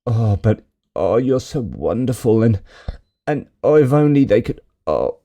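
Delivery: imitating a gushing flatterer; swooping, hammed-up sycophantic sighs; mock fawning intonation. Commentator mimicking an envoy flattering the president, mock fawning. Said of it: "the intonation of his voice is so like".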